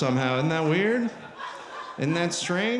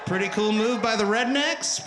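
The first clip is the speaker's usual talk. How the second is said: ordinary delivery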